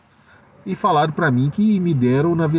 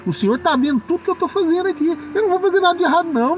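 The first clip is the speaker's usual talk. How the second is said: much higher pitched, insistent protest; high emphatic retort; 14 semitones higher, faster than usual. Retold or imitated. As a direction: pitch it up high, firmly refusing. Retold refusal to coworkers.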